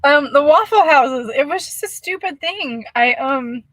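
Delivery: fighting back giggles; still giggling. Real, not acted. Explaining the joke to viewers, still giggling. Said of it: "I'm crying".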